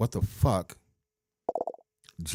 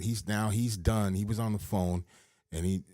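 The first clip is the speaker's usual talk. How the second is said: ordinary talk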